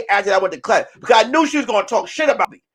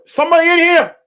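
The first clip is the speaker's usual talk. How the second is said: calling out; 7 semitones higher, 4 dB louder, faster than usual. Retold or imitated. Security guard calling out.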